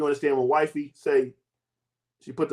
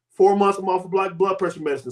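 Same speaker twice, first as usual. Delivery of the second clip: ordinary talk, nothing marked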